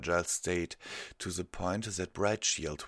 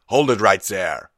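forceful shouted order; calling out; 2 semitones higher, 16 dB louder than usual. A narrator's reading. Guard halting an intruder, loud shout. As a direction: shout to halt someone. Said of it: "heard one of his guards call out".

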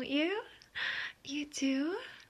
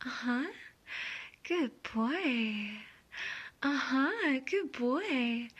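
ordinary talk

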